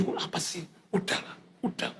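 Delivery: hushed, embarrassed protest; malu dilihat orang. Retold or imitated. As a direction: shush her under your breath. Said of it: "Saya takut dilihat orang gitu". (protes malu sambil berbisik)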